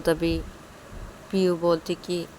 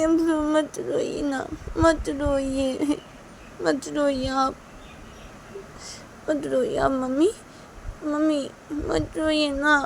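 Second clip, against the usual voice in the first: put-on higher-pitched, whimpering child voice; pleading upset child; 10 semitones higher than usual. Child asking father why, begging mother not to cry, plaintive. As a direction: plead plaintively, drawing out the words.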